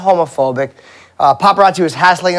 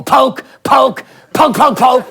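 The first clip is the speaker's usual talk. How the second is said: higher, punchy jabbing repeats; mock taunting; 5 semitones higher, slower than usual. Comedian acts out needling people until they snap, mock taunting. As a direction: poke at them with each word.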